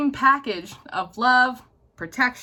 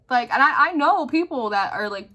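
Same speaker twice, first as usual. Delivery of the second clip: usual speaking voice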